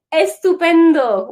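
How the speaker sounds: loud, high burst of delight; delighted exclamation. Real, not acted. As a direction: cry out in Spanish with playful excitement. Reacting to a participant's chat message.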